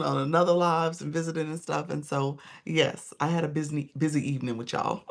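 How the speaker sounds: ordinary talk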